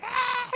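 high imitated shriek; imitated scream. Preacher mimicking a possessed girl in church, imitated scream. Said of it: "like, screaming and".

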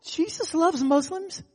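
high, slow wondering agreement; imitated dawning agreement. Disarmed man looking at the pastor, wondering agreement. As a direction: echo it slowly as if just convinced. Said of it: "this guy looked at me going".

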